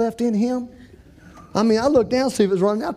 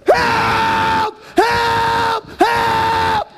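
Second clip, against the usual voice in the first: long, high, loud cries for help; high-pitched mock-panic scream; 12 semitones higher, 4 dB louder, much slower than usual. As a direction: scream it out as if on a runaway ride. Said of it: "I'm still screaming".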